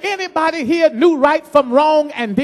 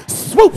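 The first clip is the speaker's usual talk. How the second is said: swoop sound; 6 semitones higher than usual. Acted. Preacher swooshes as the congregation's resolve fails. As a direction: let the swoop fly up like a missed swing.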